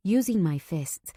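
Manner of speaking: plain reading voice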